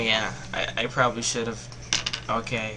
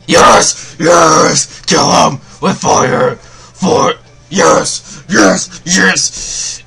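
loud, ecstatic cheering, much higher; triumphant yelling; 5 semitones higher, 12 dB louder, much slower than usual. Real, not acted. Player cheering his Pokemon's lucky hit, ecstatic shouting. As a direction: scream yes at the screen. Celebrating a winning crit.

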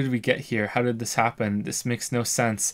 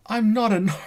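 giggling through the words; breaking into laughter; laughing. Real, not acted. Laughing at game line.

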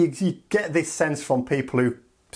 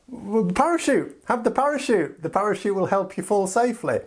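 exasperated patience; 7 semitones higher than usual. Acted. EU exasperated offer.

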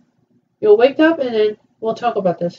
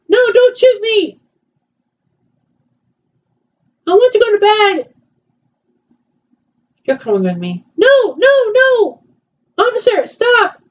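high panicked pleading; 9 semitones higher, 3 dB louder than usual. Pretend. Pleading with pretend police officer.

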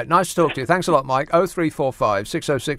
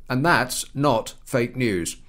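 ordinary delivery

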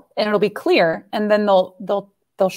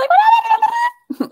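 high-pitched voice; 4 dB louder than usual. Shown as a demonstration. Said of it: "your voice is up here".

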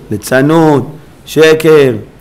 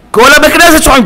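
high, emphatic exclamation; raised preaching voice; 12 semitones higher, 4 dB louder, faster than usual. Real, not acted. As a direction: press the point in a raised voice. Preacher contrasts with loud cantor. (קול רם ונוקב)

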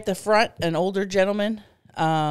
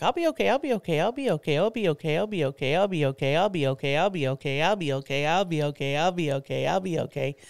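rapid repetitive mantra on a low looping tune; self-soothing mantra; 3 semitones lower than usual. Retold or imitated. Retelling sensory overload to a friend, self-soothing loop. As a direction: chant it over and over to calm yourself.

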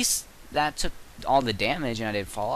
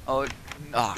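normal talking voice

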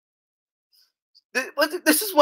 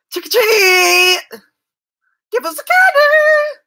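put-on much higher, loud squealing kids; kids shouting trick or treat; 5 dB louder, slower than usual. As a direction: squeal for candy at the door.

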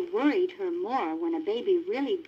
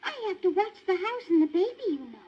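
put-on higher, earnest young dog voice; Lady insisting; 11 semitones higher, faster than usual. A narrator's reading. Lady tells the Tramp she must go home, high and earnest. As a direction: pitch up as Lady, dutiful. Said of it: "said Lady".

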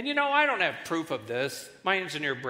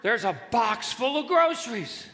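usual speaking voice

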